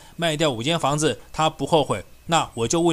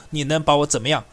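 usual speaking voice

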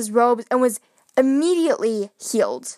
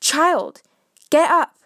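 calling out; 3 semitones higher than usual. Acted. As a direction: call the child up from her bed. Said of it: "Jesus called out".

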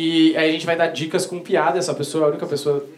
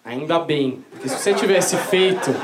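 ordinary delivery

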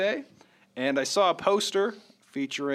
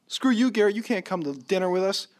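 higher, louder mock snub; mock rude brush-off; 7 semitones higher, 3 dB louder than usual. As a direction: blurt it like a rude friend. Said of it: "we weren't like".

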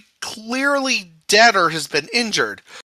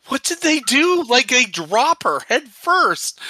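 higher, stunned question; shocked disbelief; 7 semitones higher than usual. Retold or imitated. Retelling her shock at the dropped body, stunned and higher. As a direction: ask it like you can't believe your eyes. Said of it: "And I was just like".

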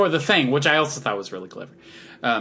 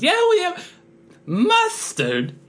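much higher put-on voice, fading to a breathy final word; a madcap host scoffing at the silly idea; cartoon impression; 3 semitones higher, slower than usual. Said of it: "that was a B minus impression".

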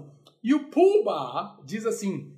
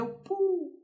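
put-on baby talk; 11 semitones higher than usual. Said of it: "o bebê fala pu".

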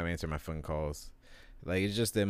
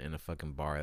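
normal talking voice

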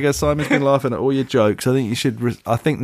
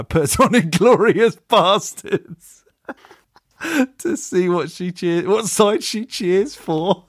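words breaking up with giggles, higher and slow; laughing while reading; 9 semitones higher, much slower than usual. Real, not acted. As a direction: struggle to read through the laughter. Reading a funny email aloud.

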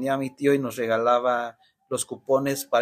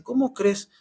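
mock disbelief; 8 semitones higher than usual. Uncle amazed by boy's plan.

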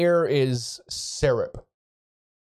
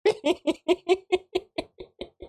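high mage laugh. Acted. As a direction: cackle in a high booming voice.

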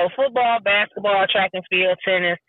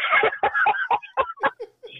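high laugh. Real, not acted. Host laughing at her hockey story, high laugh.

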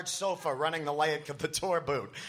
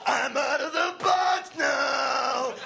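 slow, louder high sing-song shout; wild shout; 9 semitones higher, 5 dB louder, slower than usual. A narrator's reading. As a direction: howl it out in triumph. Tim freed, screaming.

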